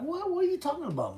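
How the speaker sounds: loud, high, incredulous question; exasperated high-pitched reply; retold bafflement. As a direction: re-enact being totally confused, voice shooting up. Retold confused reply to shouting.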